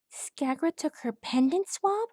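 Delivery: high questioning filly; shocked girl. A narrator's reading. Crochet asking shocked.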